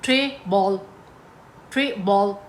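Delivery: "Tray" and "बॉल" as separate beats; one foot, syllable-split. Shown as a demonstration. Teacher showing two syllables make a foot, "tray बॉल" beat by beat. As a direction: sound out "tray", then "बॉल", deliberately. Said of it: "दो syllables को रखना है".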